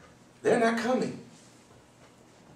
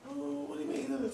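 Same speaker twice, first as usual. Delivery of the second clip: higher, softer, puzzled voice of the wife; a hint of the wife's confused protest; 8 semitones higher, 12 dB quieter than usual. Wife asking why she can't comfort her son, softer puzzled voice.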